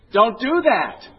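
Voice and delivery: high, loud mock scolding; exasperated shout. Psychiatrist to bulimic patient, exasperated shouting. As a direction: shout it as an appalled comic therapist.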